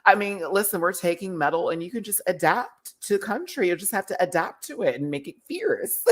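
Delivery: mock bossy advice; imitating Lux. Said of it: "Lux was making me cry".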